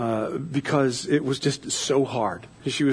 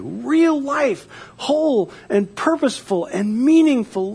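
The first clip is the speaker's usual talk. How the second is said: raised, fervent sermon voice; preaching; 8 semitones higher, 3 dB louder than usual. Acted. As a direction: preach it with rising conviction. Preaches on new life.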